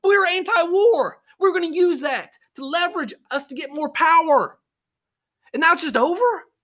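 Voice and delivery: high, incredulous squeak; mock disbelief. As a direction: sound stunned the war is over.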